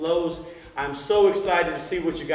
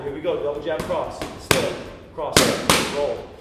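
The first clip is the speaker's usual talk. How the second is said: calling combos; 4 dB louder than usual. Shown as a demonstration. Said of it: "slow it down a little bit".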